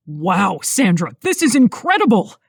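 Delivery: loud, amazed man's voice; excited. A narrator's reading. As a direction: wow them with real excitement. Man amazed by design.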